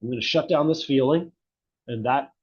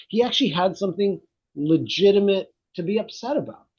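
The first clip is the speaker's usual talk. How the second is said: usual speaking voice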